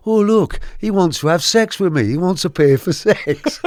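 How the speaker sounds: high-pitched imitated taunt; mocking jeer. Rival fan telling his mate about the storyteller, mocking jeer.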